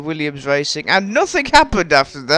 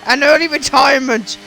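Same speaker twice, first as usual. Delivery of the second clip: sudden high, loud race-commentary outburst; startled, high-pitched play-by-play; alarmed excitement; 8 semitones higher, 4 dB louder than usual. Real, not acted. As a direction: burst out as the car nearly crashes. Startled commentary on near crash.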